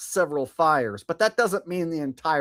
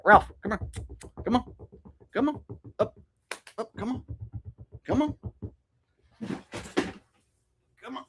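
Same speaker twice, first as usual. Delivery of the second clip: calling the dog; 8 semitones higher, 7 dB quieter, much faster than usual. Real, not acted.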